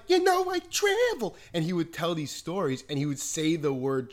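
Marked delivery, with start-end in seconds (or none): 0.1-1.4 high, loud put-on voice of the quirky teacher; Mister Weiss impression. Teen mimicking his teacher telling travel stories, high and loud. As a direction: mimic the odd substitute teacher, pitched way up. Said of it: "he'd say things like".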